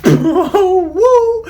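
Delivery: a high laughing woo, way up in pitch; laughing whoop. Real, not acted. Showing off his CV to viewers with a high laughing whoop. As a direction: let out a delighted whoop through a laugh.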